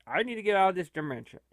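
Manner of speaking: ordinary talk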